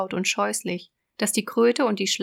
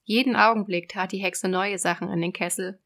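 ordinary delivery, nothing marked

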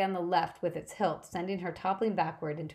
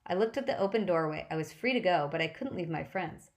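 ordinary delivery

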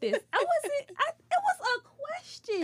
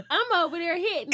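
usual speaking voice

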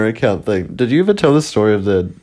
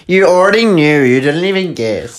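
mock commenter voice; 7 semitones higher than usual. Mocking a TikTok commenter.

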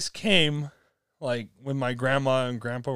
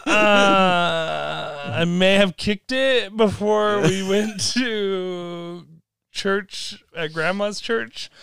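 sheepish confession; 6 semitones higher, much slower than usual. Retold or imitated. Confessing hesitantly to mom.

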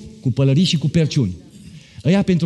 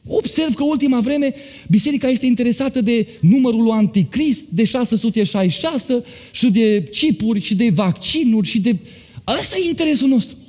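high, rising preacher's fervour; fervent preaching; 10 semitones higher than usual. Acted. Pastor lifting the church toward Christ's coming, fervent preaching. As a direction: preach it up with mounting fire. Preacher building to full force.

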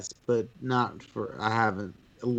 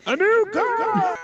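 game show announcer; 5 dB louder, slower than usual. Acted. Game show prize reveal.

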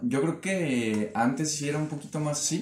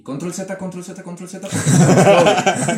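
exasperated repetition; 6 semitones higher, 7 dB louder than usual. Retold or imitated. Acting out endless undoing.

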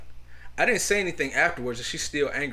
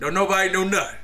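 louder, higher demand for answers; mock angry dad; 5 semitones higher, 6 dB louder than usual. Father confronting his silent kids, loud and demanding. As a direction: bark it like a fed-up father.

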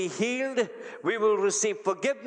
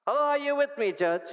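usual speaking voice

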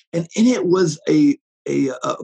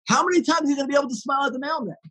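higher, fast, near shout; excited exclamation; 7 semitones higher, much faster than usual. Real, not acted. Preacher urging listeners toward small kindnesses, excited. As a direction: fire the question out with passion. Rhetorical peak of sermon.

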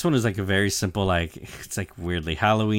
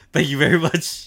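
high, louder, theatrical gratitude; exaggerated bowing thanks; 8 semitones higher, 6 dB louder than usual. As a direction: Usopp and Chopper bowing to Nami, hammed-up high thanks. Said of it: "I just like how he bows".

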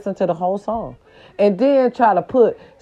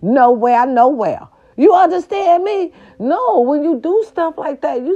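emphatic insistence; 8 semitones higher than usual. Real, not acted. Insisting on neat hair.

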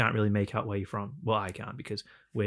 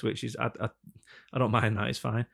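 normal talking voice

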